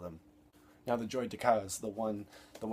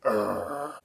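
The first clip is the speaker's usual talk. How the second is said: loud disgusted urgh; disgusted groan; 9 dB louder than usual. Shown as a demonstration. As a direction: groan like a reaction shot. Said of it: "reaction shots".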